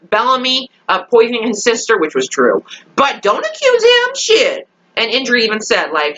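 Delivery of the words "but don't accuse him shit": very high squealed objection; indignant protest. Real, not acted. Viewer defending Bellamy from Nyla, high indignant squeal.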